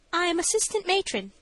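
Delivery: high-pitched matron's testimony; high character voice answering the judge; nervous matron. A narrator's reading.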